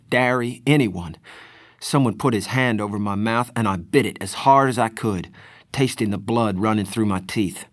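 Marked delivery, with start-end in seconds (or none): none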